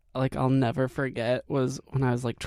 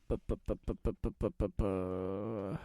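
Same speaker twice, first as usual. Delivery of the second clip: stammering bu bu; 7 semitones lower, 11 dB quieter than usual. Real, not acted. Stalling, stuttering sound.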